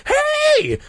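very high, loud shout. A narrator's reading. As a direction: shout after the bus. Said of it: "I shouted".

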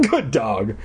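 ordinary delivery

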